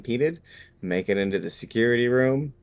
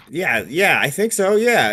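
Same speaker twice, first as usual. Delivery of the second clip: much higher, louder guard voice; eager guard; 9 semitones higher, 6 dB louder than usual. Acted. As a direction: show off eagerly to impress her. Guard NPC agreeing eagerly.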